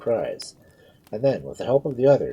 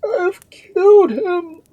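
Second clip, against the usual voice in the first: very high, loud, drawn-out wail; fearful shriek; 11 dB louder, much slower than usual. A narrator's reading. Duke lying on the corpse, fearful shriek. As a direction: wail it out in horror. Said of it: "His fearful shrieks".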